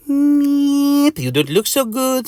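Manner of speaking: high, loud meep then concern; high meepy insect voice; Mister Mosquito. Acted. Mosquito lands beside his sick neighbour, high meepy voice. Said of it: "says Mister Mosquito".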